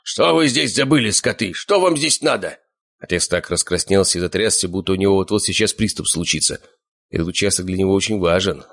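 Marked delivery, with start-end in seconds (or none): 0.0-2.6 higher-pitched angry demand; understated furious yell; yelling in his face. A narrator's reading. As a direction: demand answers angrily. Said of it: "заорав ему прямо в лицо".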